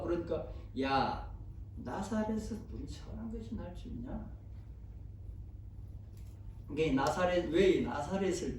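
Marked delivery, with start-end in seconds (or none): 0.8-4.3 acted sneering doubt, softly spoken; sarcastic scoff. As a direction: scoff quietly, rising at the end. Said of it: "굉장히 빈정대는 말이고". (작게 빈정대는 말투)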